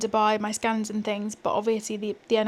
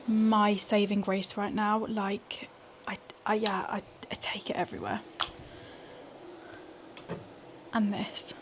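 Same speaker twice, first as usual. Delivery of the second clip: hushed night whisper; 4 dB quieter than usual. Real, not acted. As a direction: keep it hushed, late evening.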